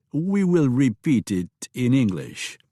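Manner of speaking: put-on slow, polite English with a light French accent; a hint of a Frenchman speaking English; lightly French-accented host. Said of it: "With your accent".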